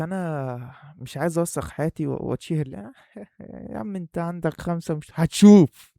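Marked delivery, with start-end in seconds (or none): 4.9-5.9 loud, hammed-up bragging yell; over-the-top boast; mock boast shout. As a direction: shout it like a cocky prophecy. Boasting the channel will blow up.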